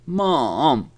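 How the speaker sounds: put-on a child protesting to mom; high, swooping tantrum whine. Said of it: "throwing a tantrum".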